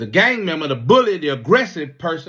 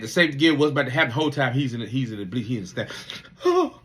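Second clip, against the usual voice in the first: normal talking voice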